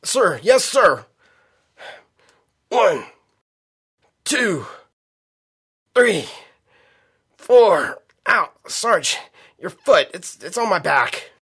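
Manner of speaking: high, loud effortful counting; strained pushups. Acted. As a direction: grunt out each count. Strained recruit doing pushups.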